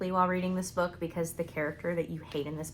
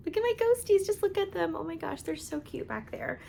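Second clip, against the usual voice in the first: cutesy high voice; 10 semitones higher than usual. Real, not acted. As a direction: coo over them like a pet. Gushing over cute ghost decor.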